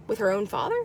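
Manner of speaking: breathy whisper of confusion; whispered aside. Real, not acted. Puzzling over an Amber Alert, whispering. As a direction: drop to a whisper, baffled.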